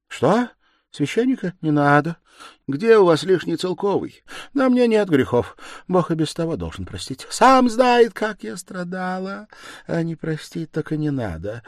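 a dying woman crying out her last bitter words; feverish dying woman. Acted. Dying woman refuses priest.

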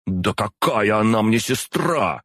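high, drawn-out outraged denial; indignant growl. A narrator's reading. Demon angrily disowns a supposed sister, indignant growl. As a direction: growl it, insulted by the very idea. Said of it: "прорычала Аза".

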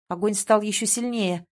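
ordinary delivery

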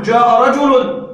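slow and loud, each word spelled out for the class; slow teaching example. Shown as a demonstration. Teacher reads an example sentence to the students, slowly. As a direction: lay out "جاء رجل" slowly so students hear the ending of "رجل". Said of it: "نحن في المفرد نقول".

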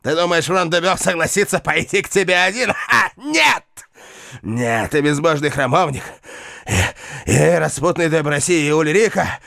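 raving madman, high and shrill; seething shrieking spirit. Acted. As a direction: rant high in mad defiance. Raving knight facing fire.

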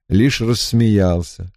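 ordinary delivery

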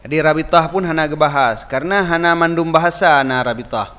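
ordinary talk

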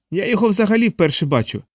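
high, pleading scared man; scared homeowner pleading innocence; frightened man. A narrator's reading.

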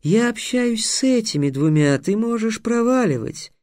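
high, irritated creature voice; haughty, high-pitched brush-off; sphinx snapping. A narrator's reading. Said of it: "рявкнул сфинкс".

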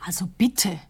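huffy unvoiced whisper; indignant whisper. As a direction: whisper it, offended.

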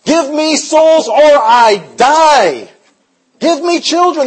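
slow, loud, drawn-out pleading cry to God; desperate church prayer, stretched and loud. Retold or imitated. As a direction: beg God out loud, slowly, for a lost man. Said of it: "The church was pleading to God".

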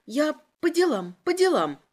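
high anxious pleading; high-pitched scared answer; frightened insistence. A narrator's reading. Prisoner pleads under interrogation.

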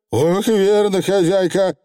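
high, cheery agreement; unabashed Feegle. A narrator's reading. Feegle caught out by the witch, cheerfully unabashed. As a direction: agree without a trace of shame. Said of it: "ничуть не смутился Явр заядло".

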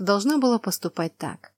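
ordinary delivery, nothing marked

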